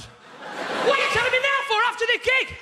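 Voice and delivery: exasperated. Retold or imitated. Exasperated retort to his mate.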